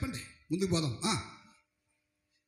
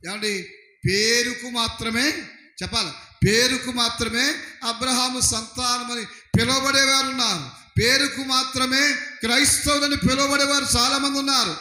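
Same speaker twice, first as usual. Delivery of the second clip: raised, insistent sermon voice; fervent preacher building louder; preaching; 3 semitones higher, 4 dB louder than usual. Acted. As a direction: build it up like a pulpit declaration. Preaching opening declaration.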